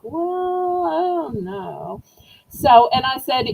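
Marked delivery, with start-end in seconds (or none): 0.0-2.0 imitating puzzled hesitation, long drawn-out "well"; drawn-out mock doubt; doubtful reply. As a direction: stretch it out slowly, mock-unsure. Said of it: "And they're like".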